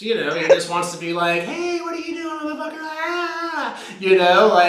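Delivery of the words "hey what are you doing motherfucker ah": squeaky high mock caller. Mimicking the friend who phones at dawn in a high squeak. Mocking the late-night caller.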